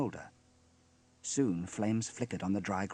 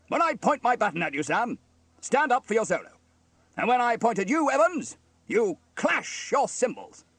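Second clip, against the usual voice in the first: high, loud officer voice, commanding; bossy fire officer; 12 semitones higher, 8 dB louder than usual. A narrator's reading. Steele instructs Sam and Evans, commanding. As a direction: give firm orders, slowing at the end.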